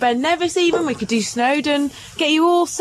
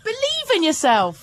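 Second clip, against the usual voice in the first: slow encouragement; 8 semitones higher, slower than usual. Real, not acted. Cheering on a reluctant friend.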